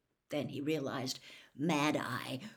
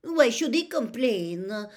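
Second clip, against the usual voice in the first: high, loud, drawn-out protest; petulant, high-pitched indignation; indignant outburst; 7 semitones higher, 10 dB louder, slower than usual. A narrator's reading. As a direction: burst out in a huff. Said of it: "burst out Fleur Delacour, stamping her foot".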